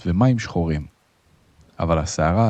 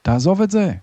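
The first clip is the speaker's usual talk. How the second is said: high, urgent cry to a mate; shouted urging; 12 semitones higher, 3 dB louder than usual. A narrator's reading. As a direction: shout it pitched way up. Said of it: "חברו צעק". (צעקה דחופה וגבוהה)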